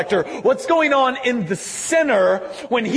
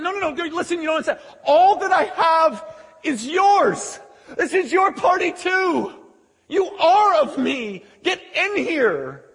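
impassioned pleading; 8 semitones higher than usual. Acted. Father pleading with older son to join party, impassioned. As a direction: implore him with rising insistence. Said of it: "he pleads with him".

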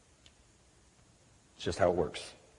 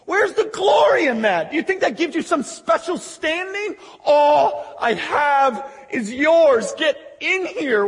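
high, crying-out appeal; impassioned pleading; 7 semitones higher than usual. Acted. Father begging proud son to share everything, impassioned. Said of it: "he begs him".

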